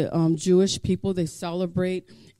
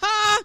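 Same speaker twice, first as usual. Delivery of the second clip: triumphant ha; 3 dB louder than usual. Real, not acted. Triumphant laugh mid-sermon.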